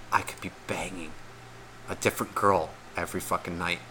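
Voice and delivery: very soft, low confiding aside; hushed retelling of a man's whisper; imitated whisper. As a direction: drop it right down, like a shameful secret. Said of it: "he literally looked at me and he whispered".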